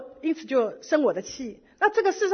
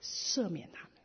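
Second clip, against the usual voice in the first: whispered words of Jesus; 4 semitones lower, 8 dB quieter than usual. Retold or imitated. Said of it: "主在十字架上所说的".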